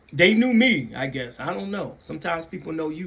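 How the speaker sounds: ordinary delivery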